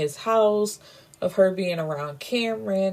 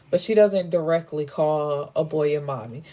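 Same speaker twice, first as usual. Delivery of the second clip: ordinary delivery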